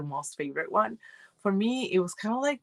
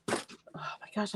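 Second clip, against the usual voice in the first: whispering; 3 semitones higher, 11 dB quieter than usual. Real, not acted. Sudden aside while cold.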